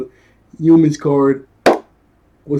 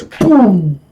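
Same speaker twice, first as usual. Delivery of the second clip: imitated boom; 4 semitones higher, 3 dB louder than usual. Imitating the tank hit.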